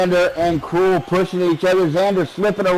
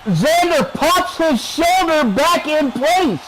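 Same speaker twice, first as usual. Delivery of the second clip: high, slow, stretched-out wrestling call; live play-by-play; 8 semitones higher, slower than usual. Real, not acted. Announcer calling a shoulder popped back in, drawn-out play-by-play. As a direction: draw out the big moment, pitched up.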